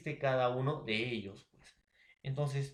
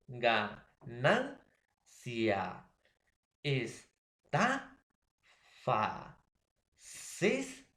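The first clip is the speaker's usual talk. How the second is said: ganancia, estafa, césped slowly split, with NAN, TA and CÉS pitched up; stress on penultimate syllable; much slower than usual. Shown as a demonstration. Teacher demonstrating graves to students, penultimate syllable stressed. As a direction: separate each syllable, lifting the next-to-last in ganancia, estafa, césped. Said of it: "la mayor fuerza de voz en la penúltima".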